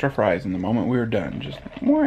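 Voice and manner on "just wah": high mock baby cry; a quick wail like a newborn; imitated baby wail.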